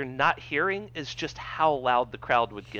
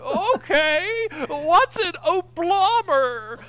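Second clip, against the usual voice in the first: put-on high, slow, toothless old man; 14 semitones higher, 5 dB louder, much slower than usual.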